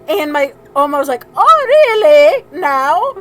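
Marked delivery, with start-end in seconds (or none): 1.4-3.1 loud, high, drawn-out bad German accent; mock an indignant Oma in bad German accent. Oma answering grandfather's tirade, bad German accent. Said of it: "very bad German impersonation".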